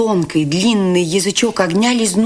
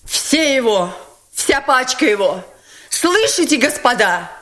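proclaiming; 8 semitones higher than usual. A narrator's reading. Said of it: "провозгласила Настасья Филипповна".

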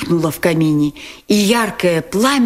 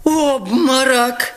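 shocked cry; 8 semitones higher, much slower than usual. A narrator's reading. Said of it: "закричали кругом".

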